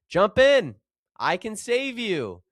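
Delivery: high, loud call from the rowboat; shouted offer. Acted. Rescuer in rowboat calls to stranded man, shouting. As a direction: yell across the water to the roof. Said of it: "a fellow shouted to the man".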